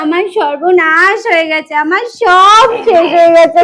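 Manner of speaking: loud, wailing lament; crying. Acted. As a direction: wail as if all is lost. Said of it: "তুমি কান্না করছো কেন?".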